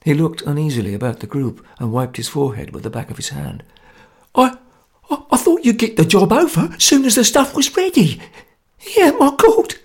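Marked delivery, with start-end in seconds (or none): 4.3-9.8 very high, stammering panic; frightened squeak. A narrator's reading. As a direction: squeak it out, terrified. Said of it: "he squeaked under his breath".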